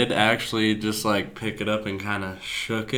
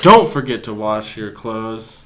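mock youtuber plug; 9 dB louder, slower than usual.

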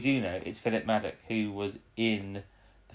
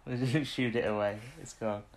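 ordinary talk

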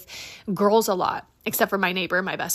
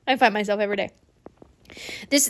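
normal talking voice

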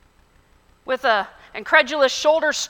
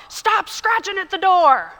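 angry shout; 5 semitones higher than usual. A narrator's reading. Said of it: "an angry man came out shouting".

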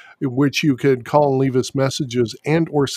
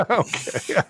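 laughing it out; 7 semitones higher, 4 dB quieter than usual. Real, not acted. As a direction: giggle through the word. Laughing after mummy joke.

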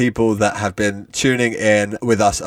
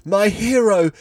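gasped swoon; 10 semitones higher than usual. Acted. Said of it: "he gasped".

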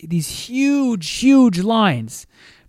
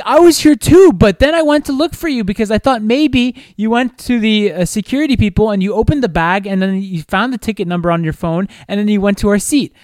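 high, loud rambling excuse; mimicking girlfriend; 4 semitones higher, 3 dB louder than usual. Girlfriend justifying herself, pitched up and defensive. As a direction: rattle off her excuse in a higher voice. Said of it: "And she was like".

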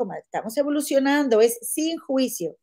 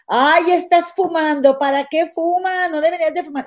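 mock nagging wife; 6 semitones higher, 5 dB louder than usual. Doña Leti scolding her husband for smoking, higher hammed-up nagging. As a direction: ham up a fussy wife fretting over smoking.

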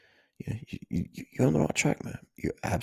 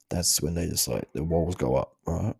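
normal talking voice

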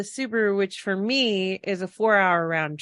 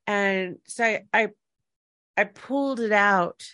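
ordinary talk, nothing marked

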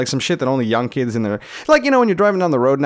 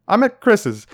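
nervous alibi; 8 semitones higher, 4 dB louder, slower than usual. Retold or imitated. Blurting an alibi to mom.

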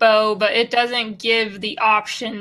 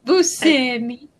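put-on Portuguese accent; slower than usual. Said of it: "How about Portuguese".

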